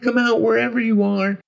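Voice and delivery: put-on high child voice calling for playmates; high, small hide-and-seek call; Tommy. A narrator's reading. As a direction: call out like a kid seeking friends. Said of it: "hear Tommy calling for the others".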